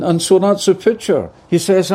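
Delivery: ordinary talk, nothing marked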